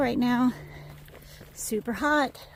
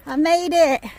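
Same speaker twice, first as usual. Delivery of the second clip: high and loud; excited shout; 6 semitones higher, 10 dB louder than usual. Real, not acted. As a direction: shout it in relief. Repeats arrival to Lenny.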